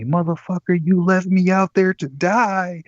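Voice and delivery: hammed-up drunk fury; mock furious yell. Girlfriend yelling at him as she hits him, mock furious.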